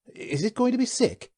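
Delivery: high anxious question; worried. Acted. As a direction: fret over the sick animal. Worried crewman.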